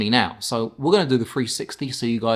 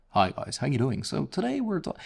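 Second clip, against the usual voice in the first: bright presenter greeting, rising high at the end; voiceover intro; 3 semitones higher, 5 dB quieter than usual. Shown as a demonstration. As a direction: sell it like a channel opening. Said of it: "the voiceover has to be, you know".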